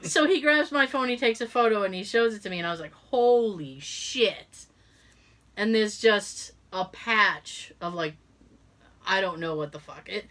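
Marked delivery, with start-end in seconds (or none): 2.9-4.6 very slow, drawn-out disbelief; stunned. Retold or imitated. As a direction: drag it out, shocked. Seeing photo of rash.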